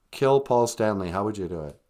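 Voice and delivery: ordinary delivery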